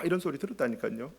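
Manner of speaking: ordinary talk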